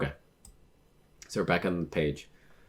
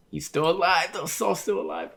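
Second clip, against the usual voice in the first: high-pitched, overjoyed outburst; thrilled; 11 semitones higher than usual. Real, not acted. Reader learning a manga character is alive, thrilled. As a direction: squeal it in real delight.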